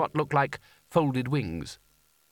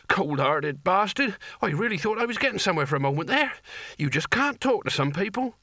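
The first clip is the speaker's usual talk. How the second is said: wheezing; 6 semitones higher than usual. A narrator's reading. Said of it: "he wheezed".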